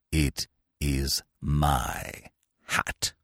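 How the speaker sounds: low, slow, icy menace; deep, deliberate, cutting disdain; cold, nasty. A narrator's reading. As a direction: spell it out coldly, deep and slow. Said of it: "in about the coldest, nastiest voice".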